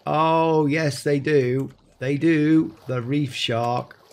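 ordinary talk, nothing marked

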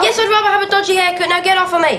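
fast, high struggling shout; wrestling. Real, not acted. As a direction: yell while twisting away. Haircut, [name] struggling free.

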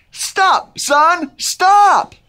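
high-pitched playful yell; high, punchy group-chant yell; yelled command. Shown as a demonstration. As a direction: shout up at the sky. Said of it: "Everyone yell".

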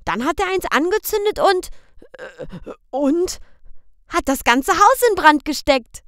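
put-on high, loud little boy's question; high child's voice; child asking. A narrator's reading. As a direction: ask papa like a worried small boy. Boy Michel finishes.

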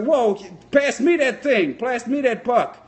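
high, slow, drawn-out call; player calling out. Retold or imitated. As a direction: call urgently for the puck. Acts player calling for puck.

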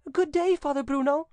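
high, bright-eyed damsel calling out; calling a greeting. A narrator's reading. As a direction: call out eagerly in a high voice. Damsel calls out to priest.

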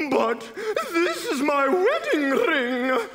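high, slow woman's wail; tearful cry. A narrator's reading. Woman giving up her wedding ring, high tearful cry. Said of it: "cried one woman tearfully".

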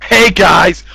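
ordinary talk

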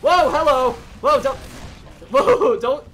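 loud, alarmed protest; startled shout. Real, not acted. Player surprised in game, startled shout. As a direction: call out in alarm.

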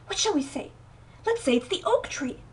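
ordinary delivery, nothing marked

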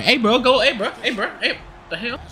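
high, urgent calls trailing off; urgent calling. Real, not acted. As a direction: rattle off high-pitched alarmed prompts. Urging a teammate mid-play.

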